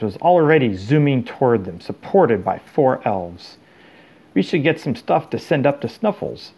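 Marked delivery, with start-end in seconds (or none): none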